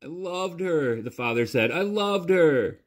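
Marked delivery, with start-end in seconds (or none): 0.0-1.0 slow, high broken protest; a father defending his love for his daughter; grieving. Acted. Father answers grieving son. 1.7-2.8 slow, high broken protest; a father defending his love for his daughter; grieving. Acted. Father repeats in grief.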